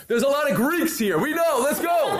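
high, loud impatient brush-off; impatient cut-off. Retold or imitated. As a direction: hurry him along. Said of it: "and I said".

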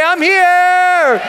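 high, loud, drawn-out yell; rousing crowd-rallying cry; triumphant shout. Acted. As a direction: holler it to lift the whole room.

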